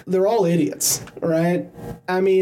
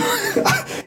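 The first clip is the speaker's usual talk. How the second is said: a burst of very high laughter; high squealing laugh. Real, not acted. Cracking up at the quote.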